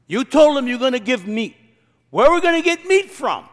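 high, loud, indignant complaint; exasperated Moses. Retold or imitated. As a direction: throw up your hands at God, voice rising. Preacher acting Moses complaining.

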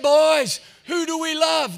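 high, loud mock drill-leader shout; rallying call. Helmeted woman rallies boys in church, high mock shout. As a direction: imitate a leader firing up the troops.

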